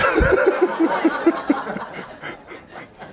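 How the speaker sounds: a long airy laugh with no voice; breathy laugh. Real, not acted. Laughs at save me from what.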